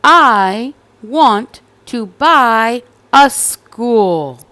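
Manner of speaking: put-on determined little girl. Cassandra asking the store lady for a school, slow and loud. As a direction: announce it firmly to the shopkeeper. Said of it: "and said".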